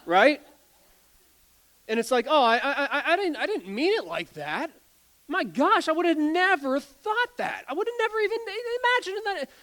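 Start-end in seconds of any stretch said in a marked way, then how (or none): 2.3-9.5 high, stammering, laughing mock apology; flustered apology. Offended friend's backpedalling, flustered and high. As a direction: fluster and stumble over the apology.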